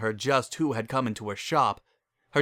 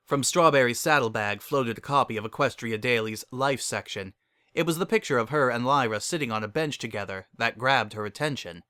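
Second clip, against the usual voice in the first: ordinary delivery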